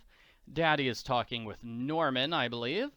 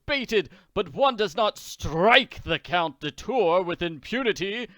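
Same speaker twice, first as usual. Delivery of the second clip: louder, higher threatening villain; vengeful Count; 5 semitones higher, 7 dB louder than usual. Acted. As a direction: menace slowing at the end. Count vows revenge.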